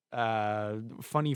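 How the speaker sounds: usual speaking voice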